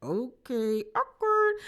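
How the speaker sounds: mock awkward, "awkward" jumping way up high; awkward reaction. Narrator mimics the room's awkward reaction, sing-song and high. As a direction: pull a cringe face with your voice on awkward. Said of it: "everybody was looking 'cause it was like".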